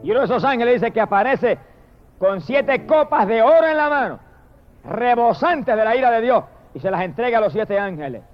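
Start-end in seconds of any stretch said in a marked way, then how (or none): none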